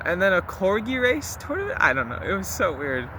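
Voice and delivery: normal talking voice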